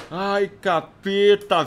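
slow pained groan; drawn-out groan. Real, not acted. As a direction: groan it out long. Frustrated gamer groans.

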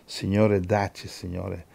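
soft, low pastoral prayer. Real, not acted. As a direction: pray quietly over the congregation, unhurried.

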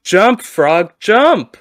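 loud, high, insistent shout; yelling at the frog. Retold or imitated. Scientist yelling again at the legless frog. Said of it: "So he yells again".

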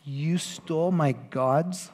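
slow, drawn-out disbelieving question; slow mocking echo of the complaint; mock incredulous. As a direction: savor how absurd it is. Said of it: "it's just kind of funny".

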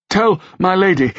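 high, strained plea; panting plea. A narrator's reading. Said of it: "said between panting breaths".

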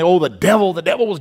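mock Pentecostal fervor, pitch climbing to a squeal; imitating Pentecostal friends. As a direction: ham up a fervent Pentecostal warning. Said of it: "my Pentecostal friends say".

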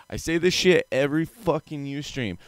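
ordinary talk

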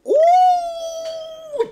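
long, very high shouted ooh; drawn-out squealing ooh; loud ooh. Real, not acted. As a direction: howl it out like a burn just landed. Reacting to the cold reply.

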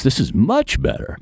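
high, delighted exclamation; light up with relief; exclaiming. Retold or imitated. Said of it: "and he exclaims".

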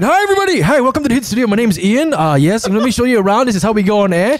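high, bright radio announcer greeting. Retold or imitated. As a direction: sell it like a cheesy radio host. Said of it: "trying to put his announcer voice".

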